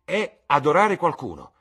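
very high, loud and ardent; passionate exclamation. A narrator's reading. Dorian declares what pleasure is to his friends, high loud exclamation. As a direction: proclaim it at full pitch. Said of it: "esclamò Dorian Gray".

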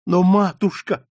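high, pleading protest; tearful. A narrator's reading. Margarita protesting to her mother, tearful. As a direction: plead with a touch of tears. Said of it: "Маргарита всхлипнула".

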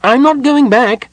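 very high, loud little voice; defiant kender. A narrator's reading. Tass refuses to go back with Parlin, defiant. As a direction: refuse loudly in a piping voice. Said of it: "Tass said, rubbing his head".

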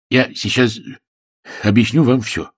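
halting voice from inside a helmet; muffled voice. A narrator's reading. Sam starts explaining from inside the armour, muffled. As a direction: speak as if muffled by a visor. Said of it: "проговорил глухой голос".

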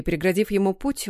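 ordinary delivery

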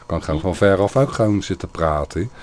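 tinny voice from a distance, mic gain turned up; speaking from afar. Shown as a demonstration. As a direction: talk into the mic from further away, loud and a bit tinny. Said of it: "je kan van ver af". (blikkerige stem, van ver af pratend)

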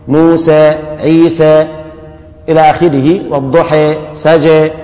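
"موسى، عيسى" ending in a tilted e; "والضحى" with imala as Hamza reads; imala examples. Shown as a demonstration. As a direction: read the list with endings leaning to e. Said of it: "أمال حمزة والكسائي".

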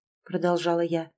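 ordinary delivery

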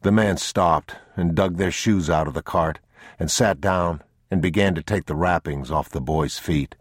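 ordinary delivery, nothing marked

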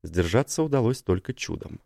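usual speaking voice

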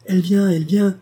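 put-on a boy shrieking the news; high-pitched cry. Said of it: "sa voix aiguë cria".

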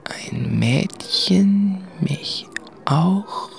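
breathless hushed whisper; slow unvoiced murmur to himself. (geflüstert, langsam)

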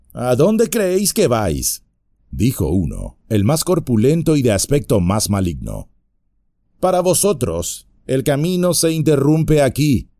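bar their way with a sneer; burly bandit voice, taunting; menacing bandit. Acted. Bandit leader blocking the road.

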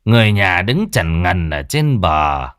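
lightly voiced gruff village elder; a hint of a rasping old man giving orders; elder scolding the villagers, lightly hoarse. A narrator's reading. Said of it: "giọng nói khàn đục".

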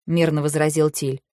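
usual speaking voice

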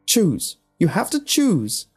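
high, loud, frantic demand; crying out. A narrator's reading. Alex slapping at Tom, crying out. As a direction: cry it out while striking at him. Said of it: "slapping at him and crying".

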